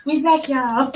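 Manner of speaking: loud laughing "We back, y'all"; laughing loud announcement. Retold or imitated. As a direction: shout "back" through a laugh. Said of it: "That's how I was like".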